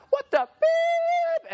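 very high, slow bleeped yell; angry yell. Retold or imitated. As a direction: scream the insult. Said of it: "I'm like".

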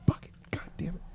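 hushed, voiceless retold swearing; whispered cursing. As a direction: curse under your breath, replaying the moment. Muttering to himself in bathroom stall.

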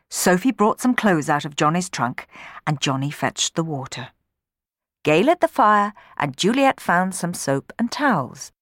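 plain reading voice

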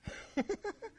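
high, soft amused chuckle; amused laugh. Retold or imitated. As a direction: laugh at the irony, up high and light. Mocking coworker's sudden belief.

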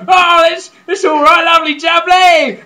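loud, high mock southern English accent; southern English bloke. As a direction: boom it as a southern geezer caricature. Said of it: "And then he's like".

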